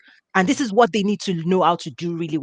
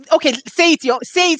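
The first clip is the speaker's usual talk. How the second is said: excited urging; 8 semitones higher, 3 dB louder than usual. Real, not acted. Host urging guest to repeat.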